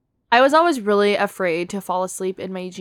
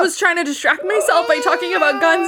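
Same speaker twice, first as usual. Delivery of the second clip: high, loud frightened shouting; scared shout; 8 semitones higher, 6 dB louder than usual. Real, not acted. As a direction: yell it in real panic. Jump scare in film.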